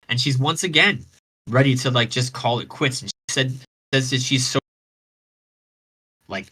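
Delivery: ordinary delivery, nothing marked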